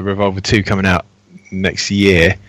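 ordinary talk

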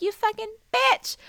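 high mock yell for Simone; imitating Simone yelling. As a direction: squeal it like an outraged pet. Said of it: "Simone's like".

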